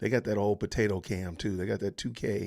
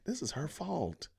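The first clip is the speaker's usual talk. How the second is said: ordinary talk, nothing marked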